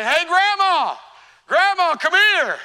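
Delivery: loud, high call across the house; boy calling for his grandma. Retold or imitated. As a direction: holler for grandma from the other room.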